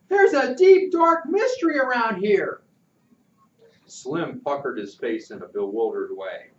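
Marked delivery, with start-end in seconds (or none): 0.1-2.6 high, loud, bursting with excitement; excited. Acted. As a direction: blurt out the mystery, thrilled. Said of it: "Pudge almost jumped with excitement".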